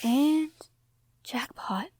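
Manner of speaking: breathless whispered jackpot; awed whisper. Acted. Treasure hunter seeing the hoard, awed whisper. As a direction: whisper it in awe at the gold.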